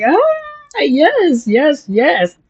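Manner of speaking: soaring high ah, then yes yes; joyful yes. Retold or imitated. Celebrating the free ride to the venue, joyful and high. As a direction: cheer it like a win. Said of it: "I'm like".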